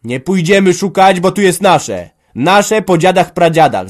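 loud, high, insistent claim; forceful cry. A narrator's reading. Son claims his forefathers' land, forcefully. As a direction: call it out with full force. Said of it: "zawołał mocno Antek".

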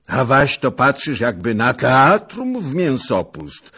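loud, high-pitched grumbling outburst; resentful exclamation. A narrator's reading. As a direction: cry out with resentment at a companion. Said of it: "wykrzyknął z niechęcią do Skrzetuskiego".

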